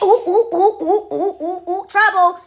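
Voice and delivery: mock alarm. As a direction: hoot like a scandalized chorus. Mimicking churchgoers warning of trouble.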